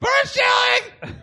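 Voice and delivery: very high parrot squawk; shrieking parrot echo; awful parrot. Acted. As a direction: screech it back like a parrot. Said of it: "he's like an awful parrot".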